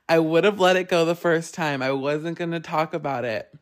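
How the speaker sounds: ordinary talk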